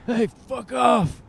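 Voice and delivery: slow, high shouted outburst; yelling. Retold or imitated. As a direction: bark it out slow and loud. Mock yelling in retold breakup.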